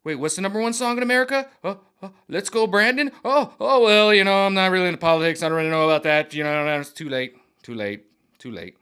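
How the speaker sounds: mock Dwayne Johnson backpedalling, loud and high. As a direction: play a celebrity dodging the question. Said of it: "he's like".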